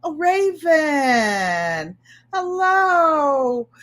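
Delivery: drawn-out, loud, high "Oh, Raven, hello"; gushing greeting. Retold or imitated. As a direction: gush over her like a grateful customer. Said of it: "They bring it in and say".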